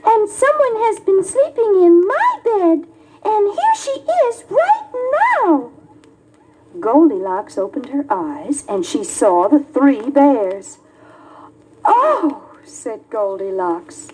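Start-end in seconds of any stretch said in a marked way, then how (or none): 0.0-5.7 much higher tiny cub, near crying at the end; a wee bear finding a stranger in his bed; high squeaky baby bear voice; Baby Bear's wee voice. Acted. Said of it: "in his wee little voice". 11.9-12.4 a much higher startled oh; high gasp of surprise; surprised. Acted. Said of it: "She was so surprised".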